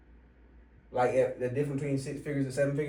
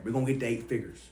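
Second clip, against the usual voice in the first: normal talking voice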